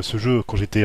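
normal talking voice

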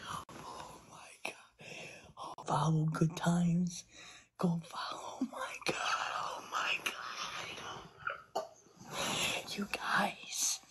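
choked up, words half sobbed out; crying whisper. Real, not acted. Streamer crying to her followers over their support, in a sobbing whisper.

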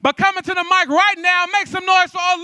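high, very loud stage introduction; hype announcer shout. Real, not acted. Host bringing Olivia to the mic, shouting. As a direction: stretch the names and rouse the room.

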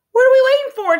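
very high, loud chirpy bird voice; bird chirp. A narrator's reading. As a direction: chirp it out like an eager little meadowlark. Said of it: "chirped June".

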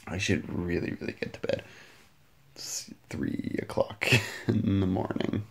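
hushed late-night whisper, breath only. Real, not acted. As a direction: whisper so no one wakes up at three a.m. Late night, trying not to wake anyone.

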